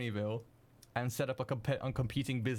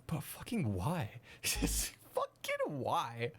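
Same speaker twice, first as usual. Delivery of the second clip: exasperated whine; 3 semitones higher, slower than usual. Retold or imitated. Answering chat who want to watch him edit, slow mock whine. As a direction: plead with them in mock despair, dragging each word. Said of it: "And I was like".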